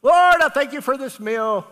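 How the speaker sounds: loud, high mock child's prayer; daughter's bold prayer. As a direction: call the grace out boldly to heaven. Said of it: "she's praying, she's holding her head up".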